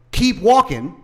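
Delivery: a loud curt order, retold; the cop barking at him to move on; mock cop bark. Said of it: "I heard the cop say".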